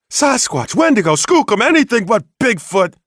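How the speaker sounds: high, loud indignant snap; snapped correction. Acted. Bigfoot hunter corrects the sheriff, snapping high and loud. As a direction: bite off each name in outrage. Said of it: "he snapped".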